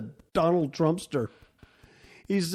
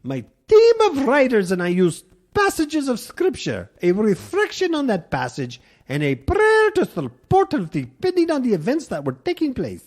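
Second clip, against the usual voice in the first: high, louder hammed-up Irish accent for the reverend; mock Irish accent; 10 semitones higher, 5 dB louder than usual. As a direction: play the reverend with a jokey Irish lilt. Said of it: "That's my Irish accent".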